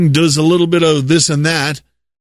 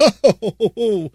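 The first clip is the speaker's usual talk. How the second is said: laugh; 5 semitones higher than usual. Real, not acted. Laughs at own mockery.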